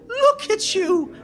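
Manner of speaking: put-on very high, slow old-lady coo; delighted old nun. Old nun greets Sam before a big hug, delighted. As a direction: draw it out in a high little voice. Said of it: "there's a little old lady".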